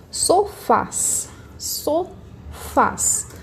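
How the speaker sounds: sofás slowly split into SO and FÁS; syllable by syllable. Shown as a demonstration. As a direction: sound out sofás in two drawn-out beats. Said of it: "Qual que vai ser a minha sílaba tônica?".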